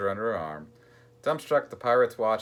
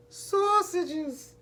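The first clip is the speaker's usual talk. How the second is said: put-on very high-pitched gleeful toddler whoop. A narrator's reading. Little Treasure asked what he wants for dinner, whooping. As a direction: squeal it out in one long whoop. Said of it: "he whooped".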